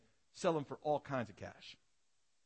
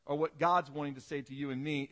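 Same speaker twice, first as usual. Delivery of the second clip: ordinary delivery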